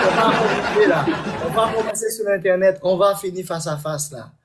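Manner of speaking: laughing while talking; chuckling through the words. Real, not acted. Flirting, laughing through his promise.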